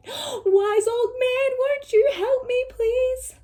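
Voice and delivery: high, pleading cry for help; a desperate old woman begging; pleading old lady. Acted. Lady begs the wise old man for help, high and pleading. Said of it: "And she said".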